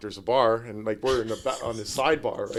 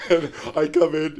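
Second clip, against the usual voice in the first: normal talking voice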